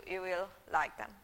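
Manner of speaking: usual speaking voice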